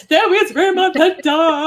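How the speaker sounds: loud, high mock sob over the line; mock tearful wail. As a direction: cry it like a heartbroken fan. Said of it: "crying over the".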